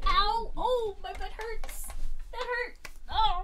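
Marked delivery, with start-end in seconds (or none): 0.1-0.5 sharp ow of pain; pained yelp. Real, not acted. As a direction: yelp as if hurt. Sudden pain, sat down hard.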